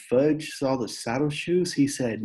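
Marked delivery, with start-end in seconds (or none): none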